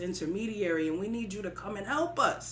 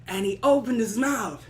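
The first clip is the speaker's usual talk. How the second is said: plain reading voice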